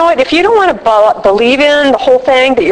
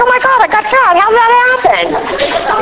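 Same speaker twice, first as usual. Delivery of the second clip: much higher, hammed-up disbelief, slowing at the end; high, exaggerated wail of surprise; mock shock; 10 semitones higher than usual. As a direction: act baffled at getting fat. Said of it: "It's like".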